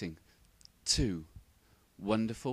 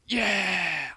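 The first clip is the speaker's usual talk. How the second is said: excited yeah; 8 semitones higher, 9 dB louder than usual. Acted. Triumphant shout in comic montage.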